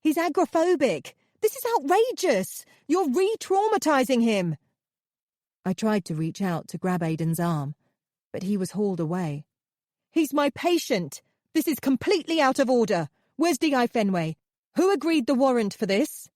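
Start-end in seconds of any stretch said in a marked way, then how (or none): 0.0-4.6 high, loud outraged protest; shrieking in outrage. Acted. Narrator protests arrest, shrieking on. 10.1-16.3 high, loud furious demands; outraged, loud demands; angry protest. Acted. Narrator protests as he's hauled off.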